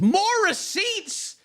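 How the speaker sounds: high, slow, drawn-out amazement; astonished. Real, not acted. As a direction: stretch it out, amazed. Mock astonishment at more evidence.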